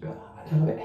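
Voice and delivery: whispered gasp from cold; breathy awe in the cold; in ice cold water. Real, not acted. (冷たさにささやくように)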